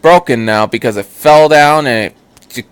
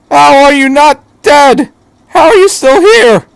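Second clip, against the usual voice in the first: much higher and louder, incredulous; disbelieving shout; 14 semitones higher, 8 dB louder than usual. Acted. Shinji yelling at the doctor in disbelief. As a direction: demand how he survived.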